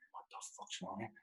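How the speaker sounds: whispered, half-asleep alarm; startled whisper. Retold or imitated. Woken early by a knock, whispering in alarm. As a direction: hush it like someone just woken at the door.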